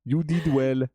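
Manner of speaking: put-on high, slow French-accented coaxing. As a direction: coax it out with a French flair. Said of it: "give it a French accent".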